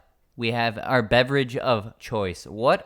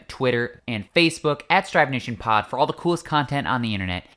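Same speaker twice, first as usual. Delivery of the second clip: usual speaking voice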